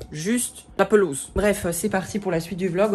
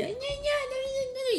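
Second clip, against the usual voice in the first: much higher, slow whiny gna gna; much higher mocking whine; mock nagging; 7 dB quieter, much slower than usual. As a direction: imitate yourself yelling in whiny babble. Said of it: "moi, je gueulais".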